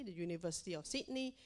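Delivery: ordinary delivery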